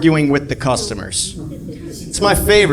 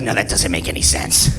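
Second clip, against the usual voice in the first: customer complaining; 8 semitones lower, 5 dB quieter than usual. Retold or imitated. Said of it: "She's like".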